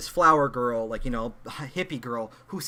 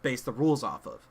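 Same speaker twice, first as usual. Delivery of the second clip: ordinary delivery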